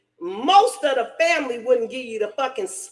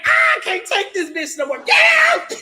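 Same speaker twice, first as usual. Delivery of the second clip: loud, pitch shooting up on get out; fed-up shout; 6 semitones higher, 5 dB louder than usual. Retold or imitated. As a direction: blow up and throw her out. Retold throwing sister out.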